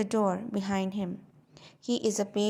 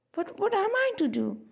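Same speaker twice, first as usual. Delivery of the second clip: pleading; 11 semitones higher, 4 dB louder than usual. Acted. Ruth pleads with the clerk.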